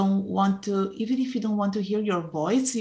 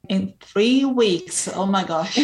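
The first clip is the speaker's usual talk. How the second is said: real astonishment at a tight deadline; taken aback; 2 semitones higher, 4 dB louder, slower than usual. Real, not acted. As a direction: draw out the shocked question.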